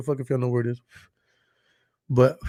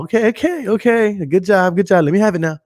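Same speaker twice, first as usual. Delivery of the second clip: higher, hurried-sounding mock exasperation; frustrated staff; 5 semitones higher, 5 dB louder than usual. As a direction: imitate a fed-up hotel worker. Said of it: "getting frustrated 'cause they're all like".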